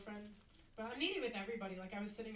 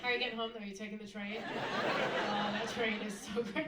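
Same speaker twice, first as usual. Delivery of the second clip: louder, slow mock rapture about the train; train enthusiast; 5 dB louder than usual. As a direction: gush over the train like it's Paris. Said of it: "you're like".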